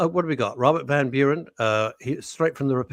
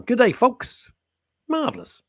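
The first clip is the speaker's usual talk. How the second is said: higher, comic reading voice; put-on voice; 10 semitones higher than usual. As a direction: give it a silly lift.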